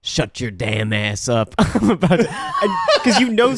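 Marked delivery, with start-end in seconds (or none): 0.0-1.6 low, slow tough-guy impression; understated, drawn-out mock menace; Steven Seagal. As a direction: growl it lazily as an action star. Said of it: "Steven Seagal's like".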